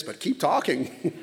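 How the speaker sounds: laughing. Retold or imitated. Jokingly urging the barber on.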